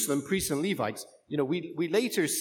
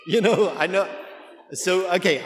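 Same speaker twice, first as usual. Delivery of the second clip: laughing; 4 semitones higher, 7 dB louder than usual. Real, not acted. Laughing aloud.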